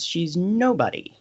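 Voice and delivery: ordinary talk, nothing marked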